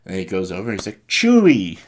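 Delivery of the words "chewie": high, loud call to a friend; joyful greeting shout. Retold or imitated. Luke greeting Chewie, excited shout. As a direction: holler the name in greeting.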